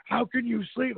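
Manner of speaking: half-mocking frantic friend; panicked buddy. Panicked Rob, bombs at night.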